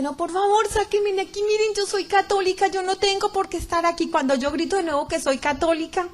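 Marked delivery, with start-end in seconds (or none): 0.0-4.1 retold desperate yelling. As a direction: beg to be let out, voice up high. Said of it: "empiezo yo a gritar de".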